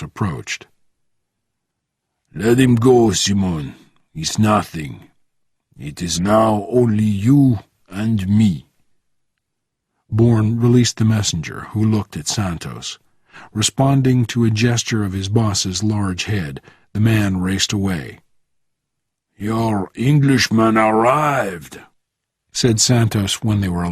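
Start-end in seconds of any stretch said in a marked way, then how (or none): 2.3-8.7 put-on accented boss calling off a fight; firm foreign-accented command, then measured; Santos, commanding. Santos orders Bourne to release his man, accented and commanding. 19.4-21.8 put-on slow accented crime boss; deliberate foreign-accented delivery; Santos, measured. Santos reports to Bourne when alone, slow and accented.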